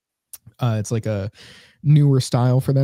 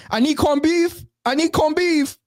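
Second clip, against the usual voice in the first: very high-pitched Caribbean deli worker; mock Caribbean accent; slower than usual. Deli worker asks a coworker for meat, mock Caribbean accent. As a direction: call it out in an imitated Caribbean accent. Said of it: "do her accent a little better".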